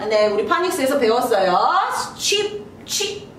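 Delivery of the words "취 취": 취 breathed out as an unvoiced ch hiss; teacher hisses 취 for the class; whispered ch sound. Shown as a demonstration. Teacher shows students the ch sound, whispered. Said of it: "취 발음이 보여요".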